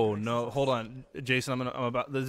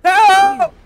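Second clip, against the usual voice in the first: very high, very loud yell; sudden mock-distress scream; shouted cry for help; 14 dB louder than usual. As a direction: belt it out at the top of your voice.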